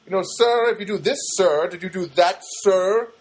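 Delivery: imitated testy, over-polite agent; fake politeness with edge. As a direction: lean on each sir with edgy politeness. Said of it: "giving me that fake politeness".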